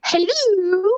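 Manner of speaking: high, sing-song "Hello" with a rise; grandma's phone voice. Retold or imitated. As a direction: answer the phone like a wary grandmother. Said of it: "She would be like".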